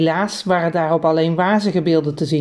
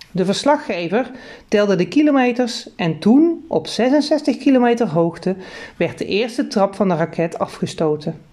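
plain reading voice

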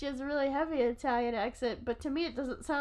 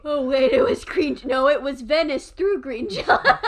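much louder exasperated correction; loud mocking echo; 10 dB louder than usual. Correcting a friend's forgotten image, loud mocking echo. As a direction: throw it back loudly, laughing at the end.

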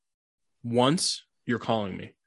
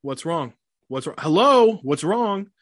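mock alarm; 5 semitones higher, 4 dB louder, slower than usual. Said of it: "I'll open the door".

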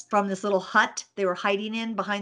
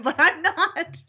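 laughing; 8 semitones higher, 3 dB louder, slower than usual. Real, not acted. Laughing at herself.

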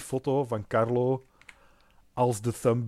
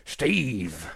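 pirate voice. Pretend. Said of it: "met een piraatstem".

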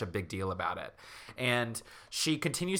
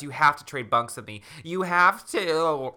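mock pleading; 4 semitones higher, 5 dB louder than usual. Sarah begs bunkmates to trade, hammed-up pleading. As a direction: beg louder, stretch the last words high.

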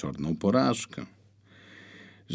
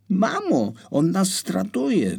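put-on high, loud child's cry of panic; panicked cry; 13 semitones higher, 5 dB louder, slower than usual. A narrator's reading. Frightened boy crying out to his mother in panic. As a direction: scream for mama in terror. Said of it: "wykrzyknął".

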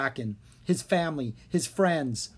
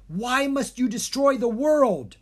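angry plea; 10 semitones higher, 6 dB louder than usual. A narrator's reading. Dharma confronts Maldred.

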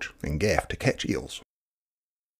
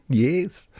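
crowing fairy; 7 semitones higher than usual. A narrator's reading. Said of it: "the impish patu paiarehe crowed".

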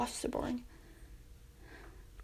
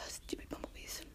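whisper; 22 dB quieter than usual. Real, not acted. Annoyed aside about the bees.

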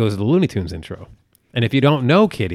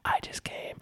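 soft whisper into an ear; 13 dB quieter, slower than usual. Retold or imitated. Husband whispering a joke in his wife's ear, whisper. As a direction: whisper it like the ad slogan. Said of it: "I just whispered in her ear".

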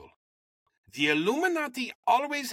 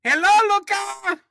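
high, loud, slow and drawn out greeting; hammed-up happy boss calling out a name; Supreme Master voice; 14 semitones higher, 8 dB louder than usual. Boss greets his recruit in a high beaming voice. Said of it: "Why is that the voice?".

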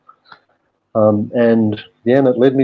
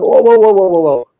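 high, loud startled "whoa"s; dad's alarmed outburst; 8 semitones higher, 6 dB louder than usual. Retold or imitated. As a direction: bark out a sharp stop, like a dad halting a kid. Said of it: "he jumped up and he was like".

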